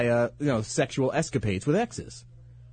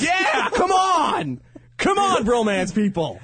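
slow, stretched cheering shout, much higher; rally the crowd, long and loud; drawn-out shout; 13 semitones higher, 4 dB louder, slower than usual. Real, not acted. Cheering for bromance fans.

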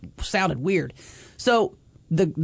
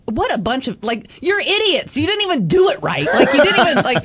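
mock scolding; 7 semitones higher, 5 dB louder than usual. Scolding the pranksters in mock address.

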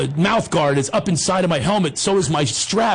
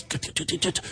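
soft, voiceless run of tuts; hushed tutting; 10 dB quieter than usual. Retold or imitated. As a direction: cut him off with quiet tuts. Coach shushes the panicking boy.

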